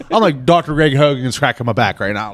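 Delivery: ordinary talk, nothing marked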